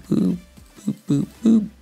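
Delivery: ordinary delivery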